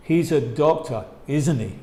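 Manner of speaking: "isn't he?" falling at the end; falling tag. Shown as a demonstration. Teacher repeating the confirming version, falling tune. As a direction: say it like you're sure. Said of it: "Con entonación que baja".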